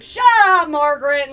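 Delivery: very loud, high yell; angry shout. Acted. Farmer yells at his nagging wife Margaret, very loud. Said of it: "he's like".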